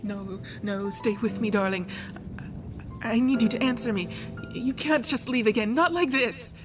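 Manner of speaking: rushed, panicked begging; desperate pleading. Acted. Pleading with darling who is slipping away.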